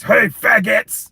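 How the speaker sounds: loud mock-tough hello; masculine Terry Crews. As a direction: swagger it out big and slow. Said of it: "he was this masculine ass".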